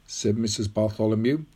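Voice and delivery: plain reading voice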